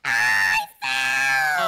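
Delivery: long, strained wail; dismayed shout. Pretend. Player falling in the game, dismayed shout. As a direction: yell it out in dismay.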